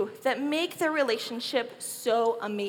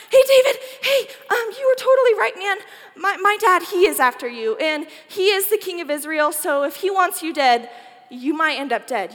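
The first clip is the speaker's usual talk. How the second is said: higher, louder, breathless alarm; urgent warning; 4 semitones higher, 5 dB louder than usual. Acted. Jonathan runs to tell David his father wants him dead, loud and urgent. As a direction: rush the warning out to a friend.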